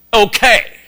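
loud, high mock-offended okay; huffy retort. Husband's huffy retort to his wife.

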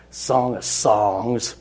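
very slow, each word stretched; drawn-out reveal. Retold or imitated. Boy names the book he was reading, slow comic reveal. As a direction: let the title land slowly for a laugh. Teller reveals the kid's awkward answer.

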